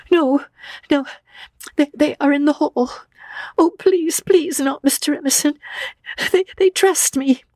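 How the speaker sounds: high, panicky, stammering begging; frantic girl in tears; sobbing plea. A narrator's reading. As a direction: beg tearfully, pitched right up. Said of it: "looked at the sobbing girl".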